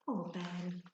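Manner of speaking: usual speaking voice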